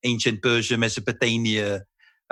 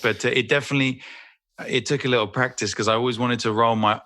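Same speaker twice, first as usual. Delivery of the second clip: ordinary delivery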